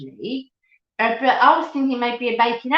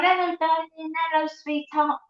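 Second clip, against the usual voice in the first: cheery Cockney hello, pitched up; Cockney greeting; 6 semitones higher than usual. Shown as a demonstration. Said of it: "calls each other love and darling".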